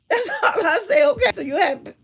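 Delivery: high, loud, laughing talk. Real, not acted. Professor recalling how she humors older students, laughing. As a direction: talk right through the laugh.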